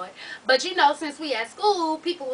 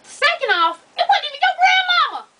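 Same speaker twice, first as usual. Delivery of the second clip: high, loud, emphatic telling-off; exasperated scolding; 14 semitones higher, 6 dB louder than usual. Real, not acted. Reader telling the letter writer she was wrong, exasperated scolding. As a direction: squeal each point in disbelief.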